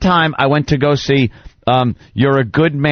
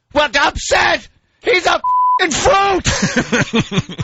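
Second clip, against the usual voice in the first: much higher, slow contemptuous dad; father mocking crying son; mock father sneer; much slower than usual. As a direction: imitate father scoffing. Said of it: "And he's like".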